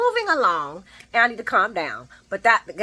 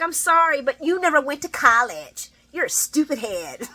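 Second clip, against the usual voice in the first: snooty Adam; 6 semitones higher than usual. Retold or imitated. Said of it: "he didn't call him a stupid head".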